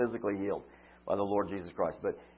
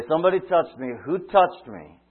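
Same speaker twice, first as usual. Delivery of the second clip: loud and higher, still not angry; raised voice; 6 semitones higher, 7 dB louder than usual. Acted. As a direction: project it over a crowd. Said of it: "You almost can't say that, uh, gently enough".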